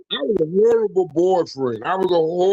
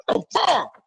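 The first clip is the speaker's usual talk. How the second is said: shocked outburst; 11 semitones higher than usual. Retold or imitated. Retold confused outburst in fight.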